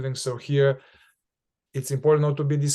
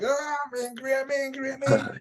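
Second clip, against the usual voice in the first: high, strained rush of mock anger; over-the-top repeating; 10 semitones higher than usual. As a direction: get carried away chanting it in a raised pitch. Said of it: "not just repeat and get into it and be like".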